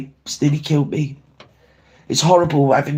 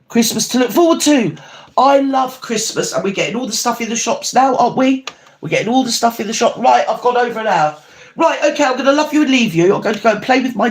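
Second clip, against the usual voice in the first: much higher, bright and bouncing; cheery excitement; 8 semitones higher than usual. Real, not acted. Signing off the stream to her viewers, cheery and excited. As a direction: gush about Christmas to the chat. Cheery sign-off about Christmas.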